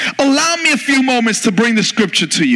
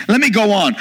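usual speaking voice

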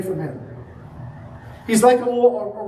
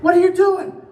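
high, louder startled outburst; shocked cry; 9 semitones higher, 4 dB louder than usual. Retold or imitated. Waiter hit with a Coke, shocked. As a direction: yelp it, caught off guard. Server gets Coke in face.

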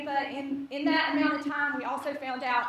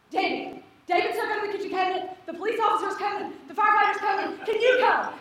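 high, rapid-fire panicked report; hammed-up breathless-paced emergency call; acted frantic call; 6 semitones higher, 4 dB louder, faster than usual. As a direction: build to a louder, urgent plea. Frantic phone call to mother-in-law, retold.

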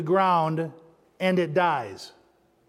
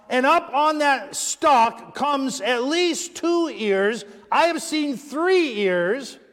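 excited preaching; 7 semitones higher, 6 dB louder than usual. Acted. Excited preaching about corn.

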